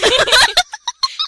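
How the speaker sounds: loud, high laughter; can't stop laughing; shrieking laugh. Real, not acted. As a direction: squeal with laughter. Laughing at silly outfit.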